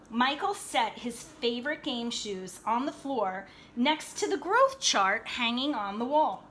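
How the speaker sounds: ordinary delivery, nothing marked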